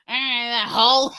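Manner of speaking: slow, drawn-out mock Memphis accent on "And that hoe."; a hint of Memphis accent, said slow; imitating Memphis talk. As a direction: lightly copy the Memphis way of talking, slowly. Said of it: "The way they talk".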